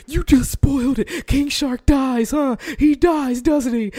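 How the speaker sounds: mock the nagging commenter. Imitating TikTok commenters accusing her, high and whiny.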